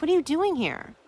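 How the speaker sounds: sudden startled ask; surprised. Retold or imitated. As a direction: caught off guard, blurt it out. He suddenly sits beside her.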